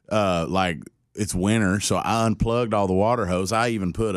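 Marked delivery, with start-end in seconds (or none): none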